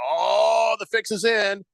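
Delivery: mock outcry. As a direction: imitate fans crying conspiracy. Voices suspicious fans in retelling.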